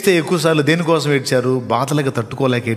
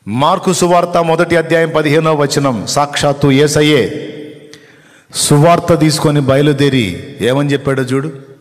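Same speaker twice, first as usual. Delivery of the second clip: loud, raised preaching voice; full-force preaching; 4 semitones higher, 6 dB louder than usual. Real, not acted. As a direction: announce the verse high and forcefully to the congregation.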